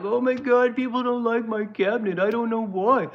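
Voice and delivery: mocking Trump whining about his cabinet; mock crying Trump. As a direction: whine it like a sulking child. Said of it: "He's crying like".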